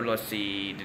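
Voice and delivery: normal talking voice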